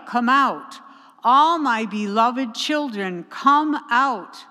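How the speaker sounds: loud repeated calls, each name flung out; crying out. Acted. God calling each beloved child from the tomb, loud calls. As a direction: summon each one out of the tomb. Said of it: "God cries out".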